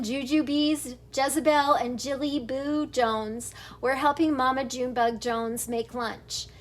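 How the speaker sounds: ordinary delivery, nothing marked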